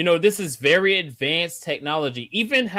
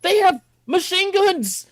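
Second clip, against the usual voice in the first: high, loud, yelling in disbelief; exasperated shout; 13 semitones higher, 5 dB louder than usual. Real, not acted. Podcaster yelling at a TV hero, furious shout. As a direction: scream it at the screen like he's an idiot. Ranting at dumb character.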